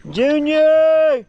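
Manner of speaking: loud, high call across the yard; calling from far away. Real, not acted. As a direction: yell the dog's name, long fall. Yelling for the dog.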